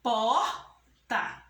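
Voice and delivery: "Porta" in two beats, Por and ta, long gap between; teacher dividing "Porta" syllable by syllable; split into syllables. Shown as a demonstration. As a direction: break "Porta" apart: Por, pause, ta. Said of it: "vamos separar?".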